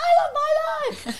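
high, loud, laughing shout; imitating a child. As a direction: shout it like a gleeful kid. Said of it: "Bella's like".

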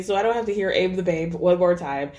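usual speaking voice

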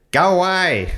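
high loud go away call; bird call go away. Shown as a demonstration. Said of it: "lets out a single or repeated".

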